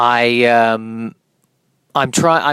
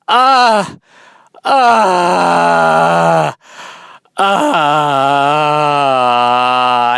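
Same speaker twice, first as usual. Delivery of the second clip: long, loud drawn-out wail; three loud cries of dismay, each held longer; anguished yell; 4 dB louder, much slower than usual. Acted. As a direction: howl as if life just hit you. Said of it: "my first reaction is, is to, is to go".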